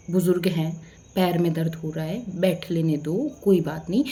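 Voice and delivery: imitating a polite, kind request; asking lovingly. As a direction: ask kindly, keeping the pitch down. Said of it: "अगर वो मुझसे प्यार से कहते ना".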